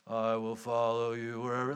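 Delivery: lightly voiced flat zombie; zombie follower. Disciples answering Jesus, lightly voiced zombie monotone. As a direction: drone it like a mindless follower. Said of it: "this zombie mentality".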